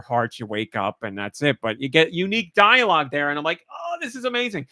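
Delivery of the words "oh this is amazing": high, soft gush; awed delight. Retold or imitated. Fan recalls finding unique dialogue, awed high gush.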